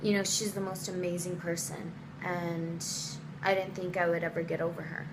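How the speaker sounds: low, soft, earnest mock confession; imitated boyfriend. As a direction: play him letting her down gently. Boyfriend's earnest breakup speech, retold.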